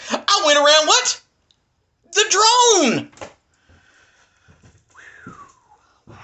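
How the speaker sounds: high, loud punchline; triumphant reveal. Real, not acted. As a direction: land the answer triumphantly.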